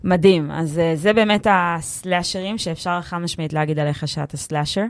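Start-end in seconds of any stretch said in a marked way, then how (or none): 4.4-4.8 סלאשר with a French R, /slaʃe/, the final R dropped; סלאשר said the French way, the last syllable rising; French accent in Hebrew. Real, not acted. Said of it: "יצא לך מבטא צרפתי".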